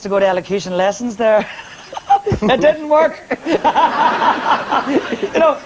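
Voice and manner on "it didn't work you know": laughing through the words. Real, not acted. Singer laughing at her own joke about elocution. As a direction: giggle out the punchline. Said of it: "What are you laughing at".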